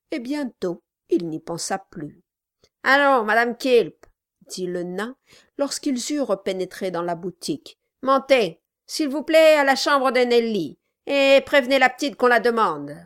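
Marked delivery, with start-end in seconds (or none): 2.8-4.1 gruff gravelly dwarf voice. Acted. Dwarf ordering wife. 8.0-13.0 gruff gravelly dwarf voice. Acted. Dwarf ordering wife.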